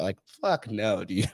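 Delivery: usual speaking voice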